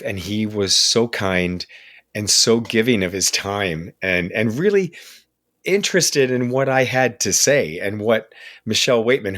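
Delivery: ordinary talk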